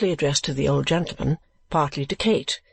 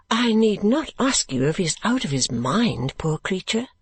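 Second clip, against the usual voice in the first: put-on higher-pitched, gentle young lady; Kate, pitying; 5 semitones higher than usual. Kate asking keeper about poor madman, pitying. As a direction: ask it sympathetically, voice raised in pitch.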